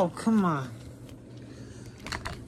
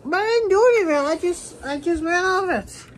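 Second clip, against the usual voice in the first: high-pitched defensive protest; protesting innocence; 7 semitones higher than usual. Pretend. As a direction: plead your innocence in a raised pitch. Suspect protesting innocence to officer.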